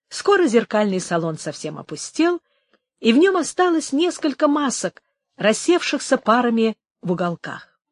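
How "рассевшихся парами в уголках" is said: usual speaking voice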